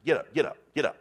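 mock alarm, rousing a sleeping husband; lightly acted wake-up shout; urgent wake-up. Wife slapping him awake.

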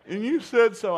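high, loud wailing daughter voice; daughter crying. Retold or imitated. Daughter wailing at dinner to her dad, loud and high-pitched. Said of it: "she finally just started busting out crying".

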